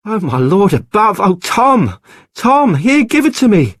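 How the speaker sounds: high, loud urgent pleading; alarmed breathless outburst; frantic cry. Acted. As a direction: demand the baby from husband, desperately. Isabel grabs for the baby.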